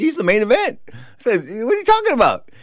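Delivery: high, disbelieving retort; retold alarm at a no-show; incredulous protest. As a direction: argue back with pitch jumping up.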